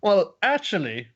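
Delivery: put-on girlish well-actually; Nodoka's voice. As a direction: gently set them straight. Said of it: "Nodoka says".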